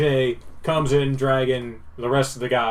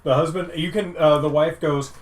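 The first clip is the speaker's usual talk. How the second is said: normal talking voice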